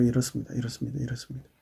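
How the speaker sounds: softly repeated, fading away; subdued confiding. Acted. Believer tells God privately how things are, subdued. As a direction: lay it before God under your breath. Humbly reporting troubles before God.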